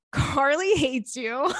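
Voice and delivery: slow, drawn-out mock of the boyfriend. Imitating her ex reporting what Carly said, slow mockery. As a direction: stretch it out to make him sound dumb. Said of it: "And he was like".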